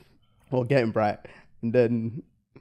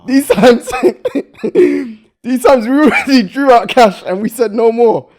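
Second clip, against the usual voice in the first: laughing; 9 semitones higher, 8 dB louder than usual. Real, not acted. Laughing, retelling the cash drama.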